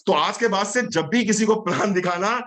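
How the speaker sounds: ordinary talk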